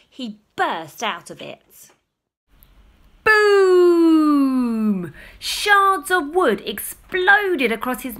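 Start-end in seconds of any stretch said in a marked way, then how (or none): 3.2-5.1 a big high booming shout; loud boom. A narrator's reading. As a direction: blast it like an explosion. Cot bursts.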